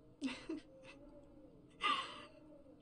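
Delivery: high wailing sob; sobbing as the grief spills over; crying sob. Acted. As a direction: let out a breaking cry. Breaks down over lost partner.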